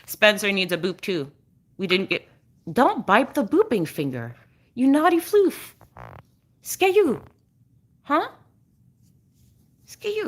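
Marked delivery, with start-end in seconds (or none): 4.7-10.2 high sing-song pet voice, much higher. Real, not acted. Owner teasing her naughty dog in a high, cooing pet voice.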